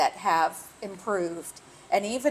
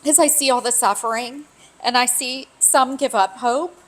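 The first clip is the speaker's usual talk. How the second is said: holding back tears while speaking; teary; 5 semitones higher than usual. Real, not acted. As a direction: voice on the edge of tears. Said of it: "I really didn't think I was gonna get teary".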